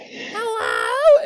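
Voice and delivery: squeaky high helium voice. Retold or imitated. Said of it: "you sounded like you were on helium".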